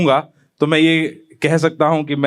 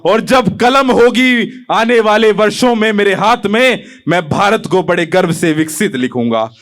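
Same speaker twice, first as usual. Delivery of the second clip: raised declamation, dropping on the final line; poem recitation; 5 semitones higher, 4 dB louder than usual. Acted. Young poet pledging to his country, declaimed. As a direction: proclaim each line, then bring it down.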